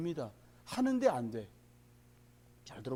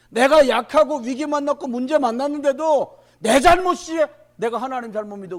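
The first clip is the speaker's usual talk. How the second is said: preaching at full force; 6 semitones higher, 12 dB louder than usual. Acted. Pastor warning the congregation about the times, loud and high.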